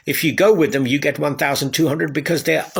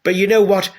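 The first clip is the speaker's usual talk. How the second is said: usual speaking voice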